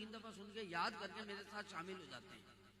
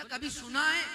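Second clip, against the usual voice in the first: loud, higher, slow challenge; challenging question; 7 semitones higher, 17 dB louder, slower than usual. Acted. Sunni grilling the objector, loud and slow. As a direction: corner him with the question. Acted argument about milad.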